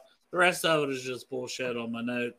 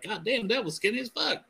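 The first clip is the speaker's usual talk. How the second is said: incredulous reaction, pitched way up; mock astonishment; 9 semitones higher than usual. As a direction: imitate an astonished onlooker, voice climbing. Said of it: "be like".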